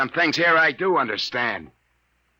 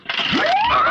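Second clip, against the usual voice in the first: sharp high cry of pain, loud; 5 dB louder than usual. Acted. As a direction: cry out as the ray squeezes. Pressure ray crushing him.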